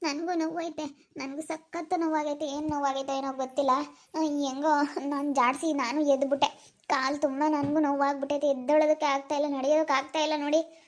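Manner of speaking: soft, pained complaining about a hurt leg. Acted. Woman with a hurt leg pleading with Pravi, soft and pained. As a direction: talk quietly, slowing near the end as if it hurts to move. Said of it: "ನನ್ಗೂ ಕಾಲ್ ನೋವಾಯ್ತಪ್ಪ".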